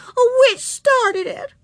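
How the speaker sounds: high, slow, loud wail; sobby little-girl accusation; tearful. A narrator's reading. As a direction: tattle through tears, drawing it out. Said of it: "said Susan tearfully".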